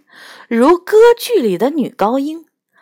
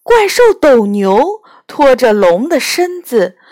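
plain reading voice

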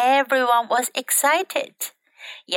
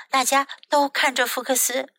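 plain reading voice

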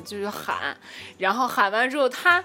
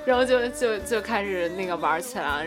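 ordinary talk, nothing marked

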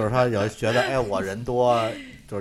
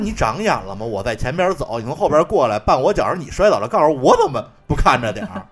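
retold angry retort; 3 semitones higher, 7 dB louder than usual. Retold telling her off.

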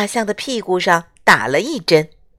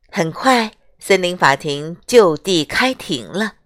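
ordinary delivery, nothing marked

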